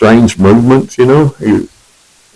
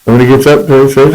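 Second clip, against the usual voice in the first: ordinary talk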